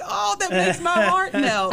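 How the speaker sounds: high, loud and slow swoon; heart melting. Real, not acted. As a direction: swoon over every word. Mother gushing about her son.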